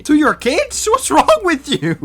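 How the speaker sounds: high, loud incredulous what; outraged disbelief. Real, not acted. Outraged at the dad judging a stranger, high and loud.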